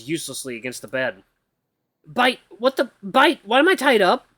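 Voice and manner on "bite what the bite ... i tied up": high, loud panicked calls for the AI; waking up chained and terrified; panicked cries. Acted. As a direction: yell in a rising panic. Tied up and panicking.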